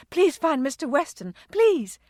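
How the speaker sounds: high, urgent begging; desperate plea. A narrator's reading. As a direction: beg for help, rising on the last please. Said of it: "clutching at his arm desperately".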